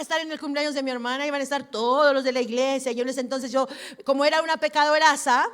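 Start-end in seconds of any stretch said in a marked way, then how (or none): none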